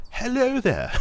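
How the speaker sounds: mock his mum's posh phone greeting. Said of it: "try and speak very posh on the telephone".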